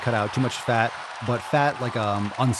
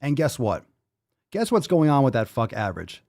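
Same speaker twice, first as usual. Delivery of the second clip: usual speaking voice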